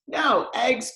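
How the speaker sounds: high dismayed cry; cried out. Acted. Farmer reading the hens' note, crying out high. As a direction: yelp it in shock. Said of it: "cried Farmer Brown".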